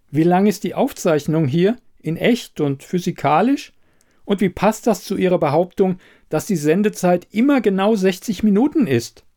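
high-pitched, pressing questions; interrogating investigator. Acted. Elida questioning the quizmaster's sixty-minute claim, pressing.

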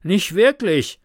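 winded, starting on a puff. A narrator's reading. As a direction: huff it out between breaths. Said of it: "schnaufte Karl".

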